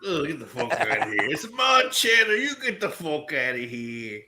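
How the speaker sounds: hammed-up New Jersey brush-off; Jersey accent. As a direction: drag out the Jersey vowels, mock indignant. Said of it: "a Jersey accent does it for you".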